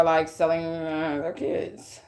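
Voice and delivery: halting and trailing down softly; reluctant, stopping oneself. Real, not acted. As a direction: hold the words back, then let them out quietly. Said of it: "see me trying to stop myself".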